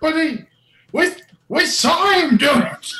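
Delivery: high, loud townsperson voice; hammed-up high villager protest. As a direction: stammer out a high accusation. GM as villager argues back.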